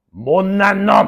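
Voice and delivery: drawn-out mock scolding; old teacher's scolding. Old teacher hitting a pupil, mimicked scolding. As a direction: imitate an old man berating a pupil. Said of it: "처음 들었던 소리가".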